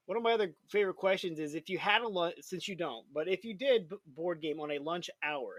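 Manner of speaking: normal talking voice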